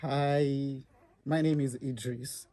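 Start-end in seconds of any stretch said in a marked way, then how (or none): none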